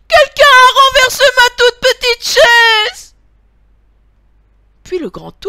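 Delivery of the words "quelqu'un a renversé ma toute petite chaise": tiny, piercing, very high shriek; piercing little bear cry. A narrator's reading. Tiny bear finds his chair knocked over, piercing high cry. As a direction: shriek it like an outraged baby bear. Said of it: "crie de sa petite voix perçante".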